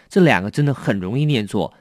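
ordinary talk

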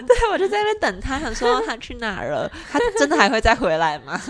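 laughing through the words; giggling while telling it. Real, not acted. Laughing while retelling the wait.

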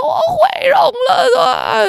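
high, slow crying wail through tears; retold sobbing wail. Retelling to her friend on the phone, a high sobbing wail. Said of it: "然后我就大哭哦".

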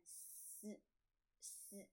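S as a long s sound with a tiny vowel; consonant demonstration. Shown as a demonstration. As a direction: draw S out slowly, sss. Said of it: "英文字母S是念S".

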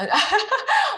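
high squealing laugh; burst of laughter. Real, not acted. Friends chatting, she bursts out laughing at the joke. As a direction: laugh out loud in delight.

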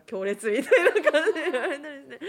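helpless laughter breaking into speech; laughing. Real, not acted. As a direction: crack up while telling it on yourself. Laughing at own teasing story.

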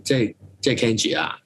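normal talking voice

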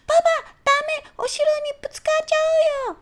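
put-on very high, urgent child shout; loud warning. Child shouting at dad not to bump the castle, high and urgent. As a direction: shout to stop dad in time. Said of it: "大声を出しました".

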